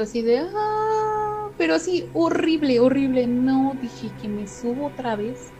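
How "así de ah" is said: long drawn-out high wail, slowed right down; mock wailing lament. Narrator imitating the Llorona's lament she heard at night, high drawn-out wail. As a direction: stretch it into a ghostly moan. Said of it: "con el lamento".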